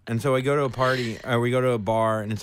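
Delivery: ordinary delivery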